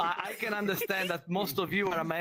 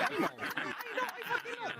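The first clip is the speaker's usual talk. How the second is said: mock exasperated; 4 dB quieter, much faster than usual. Comedian mock exasperated at the Mario jokes.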